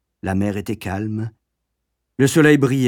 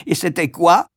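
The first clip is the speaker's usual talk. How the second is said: question sèche; 6 semitones higher, 4 dB louder than usual. A narrator's reading. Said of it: "demanda sèchement".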